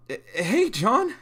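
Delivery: high, startled stammering hello; nervous stammer. Acted. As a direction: stumble over the greeting as if caught off guard.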